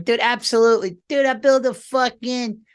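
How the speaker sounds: high mock Bobby, loud and gleeful; Bobby impression. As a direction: ham up a buddy who's all in on the cabin. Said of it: "I could see Bobby going".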